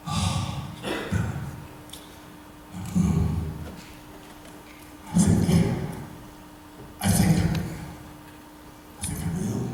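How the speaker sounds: near-silent, unvoiced whisper of a worried friend; hushed confession. DeForest late at night confessing to Bill, whispered. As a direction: breathe it out as if afraid to be overheard.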